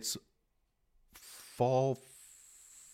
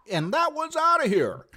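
high excited play-by-play cry; sports announcer call; 5 dB louder than usual. Pretend. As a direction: call the home run like a broadcaster. Baseball home run call.

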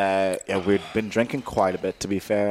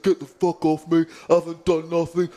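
put-on higher, angry drunk denial; mock drunk mate fighting off a grip; drunk angry protest; 9 semitones higher than usual. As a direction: shove him off and swear you did nothing. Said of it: "And Luke's like".

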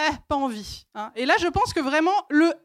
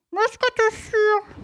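nagging voice; 7 semitones higher, 3 dB louder, slower than usual. Acted. Acted pestering question.

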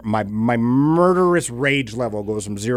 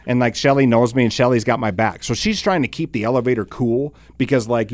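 ordinary talk, nothing marked

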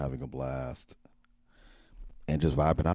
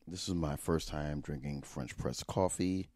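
usual speaking voice

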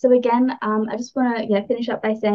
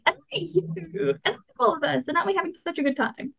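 playful show-off voice, lightly higher and softer; showy look-at-me voice; 6 semitones higher, 6 dB quieter than usual. Retold or imitated. Host mimics Leo's look-at-me energy, playful showing off. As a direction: gush at everyone like a glittering Leo. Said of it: "look at me" energy".

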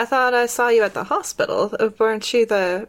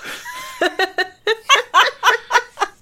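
high giggle; 12 semitones higher than usual. Acted. Gladys giggles at the squeeze.

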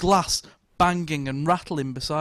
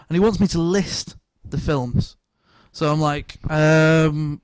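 usual speaking voice

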